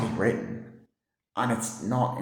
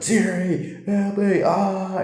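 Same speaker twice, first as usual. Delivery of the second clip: high, strained cry for help; hammed-up gamer panic, much higher; mock panicked screaming; 10 semitones higher, much slower than usual. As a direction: shriek it like a scared streamer. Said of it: "he started screaming".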